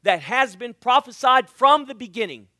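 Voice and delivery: loud, rising preacher's build; preaching at full force. Acted. Preacher booming to the church, fervent. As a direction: proclaim it to the congregation.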